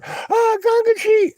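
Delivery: very high, gushing mock cry; squealed imitation of a devoted crowd; mock adoring followers. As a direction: swoon over the guru, hammed-up.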